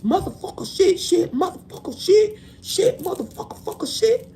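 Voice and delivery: playful impression of a guy cussing nonstop; mock crude rant. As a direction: rattle off the swears like a foul-mouthed dude. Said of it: "a dude who was just, like, talking like".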